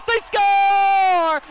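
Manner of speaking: drawn-out shouted goal call; long goal yell. Real, not acted. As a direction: hold score loud.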